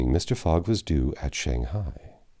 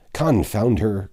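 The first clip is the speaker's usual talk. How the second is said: a sharp, louder curse at the steamer; the pilot spits out his frustration, raised and high; angry cry; 5 semitones higher, 5 dB louder, slower than usual. A narrator's reading. Pilot curses the departing steamer, angry loud cry. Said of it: "cried John Bunsby".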